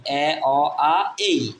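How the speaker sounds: এ, অ, আ, এই as four separate vowels; vowel sounds demo. Shown as a demonstration. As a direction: sound out এ, অ, আ, এই one by one. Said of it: "এর উচ্চারণ চার রকম".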